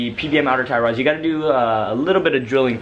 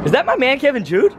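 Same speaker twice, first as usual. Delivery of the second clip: excited greeting; 10 semitones higher than usual. Real, not acted. Greeting a friend.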